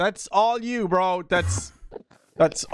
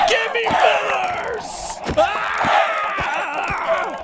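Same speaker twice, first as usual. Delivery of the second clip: shouting; 7 semitones higher, much slower than usual. Real, not acted. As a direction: bellow it out, slowly. Shouting for feathers again.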